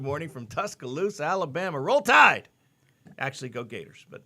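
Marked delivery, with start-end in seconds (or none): 1.8-2.5 loud, high football cheer. Real, not acted. Host cheers for a viewer from Tuscaloosa, loud. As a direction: shout it like a stadium fan.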